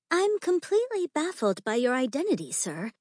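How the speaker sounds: put-on high-pitched young lady's voice; young society lady. Young lady addresses disguised Gabriella.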